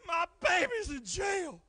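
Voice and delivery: high, slow wailing; imitating crying mother. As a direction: wail it through tears. Crying mother at her door.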